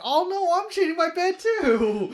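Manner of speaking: high, hammed-up panicked cry; panicked character voice. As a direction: squeal it out in mock panic. Character panics in retold scene.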